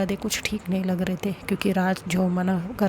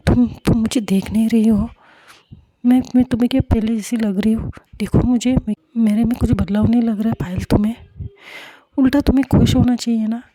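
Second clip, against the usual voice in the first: loud, fast, indignant protest, pitched up; angry outburst; 2 semitones higher, 7 dB louder, faster than usual. Acted. Samiksha to Payal, denying any change, loud angry outburst. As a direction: hurl it back at her, fast and loud. Friends quarrel, Samiksha defends herself.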